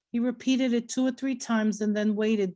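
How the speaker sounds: ordinary delivery, nothing marked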